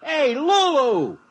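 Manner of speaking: loud, high, slow call. Acted. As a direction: shout up to someone far off. Calling out up to Lulu.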